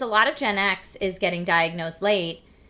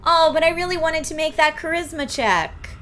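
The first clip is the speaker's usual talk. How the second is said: higher, louder mocking whine; 9 semitones higher, 6 dB louder than usual. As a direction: whine like a sore loser. Mocking a save scummer.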